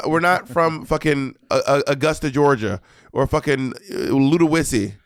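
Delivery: ordinary talk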